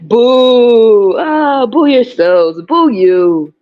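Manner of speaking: loud, drawn-out mock jeer; playful booing. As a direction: heckle back with a long loud boo. Booing back at viewers.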